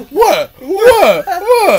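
baffled what. Retold or imitated. Coworker caught out by the joke, loud baffled repeats.

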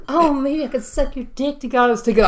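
high put-on coy offer; squeaky girl voice. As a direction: imitate a bad actress playing girlish. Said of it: "still keeps going the voice".

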